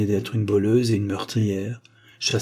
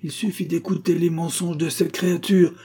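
high-pitched, scornful dismissal; indignant; 8 semitones higher than usual. Acted. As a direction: wave the accuser away in outrage. Indignant denial of Rosa's accusation.

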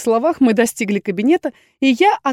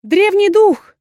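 high, loud delighted cry; excited exclamation; 10 semitones higher, 5 dB louder, slower than usual. A narrator's reading. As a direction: gasp it out in awe. (восторженный возглас)

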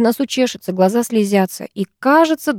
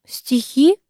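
high, rising question; mildly baffled echo; puzzled; 6 semitones higher than usual. A narrator's reading. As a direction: ask it taken aback and unsure. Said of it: "озадачилась Нелли Николаевна".

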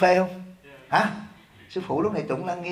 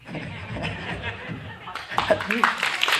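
soft laugh; 6 semitones higher, 7 dB quieter than usual. Real, not acted. Laughs at own joke.

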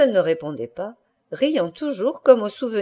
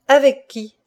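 higher, louder repeated question; insistent demand; 6 semitones higher, 6 dB louder than usual. A narrator's reading. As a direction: press hard for the name. Husband repeats his unanswered question. (question insistante, plus aiguë)

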